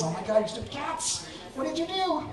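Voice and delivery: mock scolding the cats, last bit jumping high; hammed-up panic at the stupid cats; exasperated scolding.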